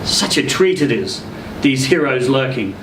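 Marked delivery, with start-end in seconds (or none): none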